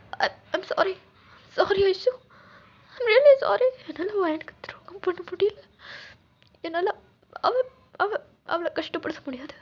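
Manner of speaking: acted sobbing plea; tearful apology. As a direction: apologise through tears, stumbling on the words. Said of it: "எதுக்கு அழுகுற?".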